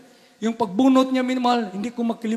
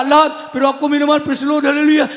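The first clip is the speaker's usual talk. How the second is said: normal talking voice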